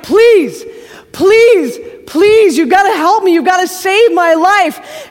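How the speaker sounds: cornered man begging the queen; desperate begging. Acted. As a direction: beg for your life. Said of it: "he's begging".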